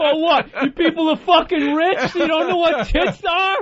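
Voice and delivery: high put-on jeering voice breaking into laughs; giggling high mock voice; laughing heckler. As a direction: laugh through the crude taunt. Still playing the crude guest.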